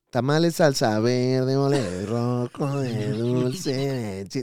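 tamale vendor call. Retold or imitated. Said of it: "esa es la cantaleta".

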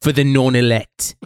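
a hammed-up Aussie voice, noticeably off from his own; bad Australian accent. Teasing friend, bad Australian accent. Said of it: "the worst Australian accent I've ever done".

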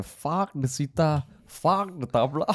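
hint of the Bombay friend's Indian accent; lightly done Indian accent, dismissive. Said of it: "I will use the accent because I'm Indian".

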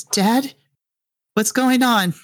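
put-on high-pitched young voice; worried child. Child calling dad over the radio, high and anxious. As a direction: pitch it up as a confused kid.

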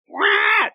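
high shrieking alien cry; alien screech. Shown as a demonstration. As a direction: screech like a xenomorph. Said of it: "That's my alien noise".

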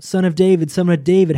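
crying out for mercy. Retold or imitated. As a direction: call out across a crowd, begging to be heard. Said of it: "he continues to cry out".

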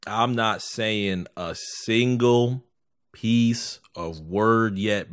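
every word dragged out slowly; slow and deliberate. Acted. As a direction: spell it out slowly, making a point. Slow emphatic vow.

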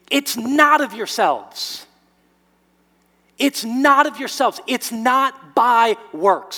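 "not" punched loud and slow; stress on NOT. Real, not acted. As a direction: hammer the word "not" home. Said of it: "preaching emphasis".

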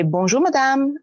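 "Bonjour, madame" lifted high and courteous; polite French greeting. Shown as a demonstration. Teaching Americans the French shop greeting, lifted polite. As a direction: sing out "Bonjour, madame" brightly upward. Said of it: "You have to say".

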